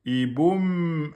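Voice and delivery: i, boum with the lips held shut on the m; \"boum\" ending on a closed, held m; lips kept closed. Shown as a demonstration. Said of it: "Vous gardez les lèvres fermées".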